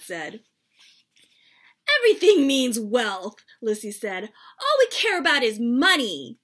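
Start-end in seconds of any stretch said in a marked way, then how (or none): 2.0-3.4 put-on loud childish protest, voice up; complaining loudly. Child protesting to Dad about money, loud complaint. Kids protest at dinner table. 4.6-6.4 complaining loudly. Acted. Kid protests dinner meanings.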